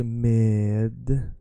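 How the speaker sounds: med said slowly with the D clear. Shown as a demonstration. Teacher showing med said slowly with D. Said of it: "you wanna say it slowly".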